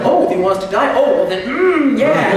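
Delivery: hammed-up surprised turnaround; loud mock surprise.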